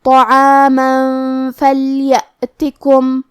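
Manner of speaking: طعاما with a heavy to, then very slow فلیأتکم, the tanween hummed into fa; tanween hidden with ghunna. Shown as a demonstration. Teacher reciting for students, tanween hidden with ghunna. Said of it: "حکم کو غنہ کے ساتھ ادا کریں گے".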